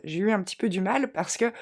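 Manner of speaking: ordinary talk, nothing marked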